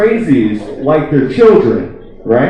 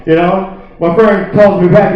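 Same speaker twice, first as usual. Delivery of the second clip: ordinary delivery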